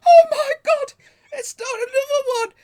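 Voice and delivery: high, loud wailing despair, almost crying; fat guy freaking out. Retold or imitated. The seller hears of yet another advert, loud high wail. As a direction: wail it up high like a man who can't take another call. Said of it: "He went".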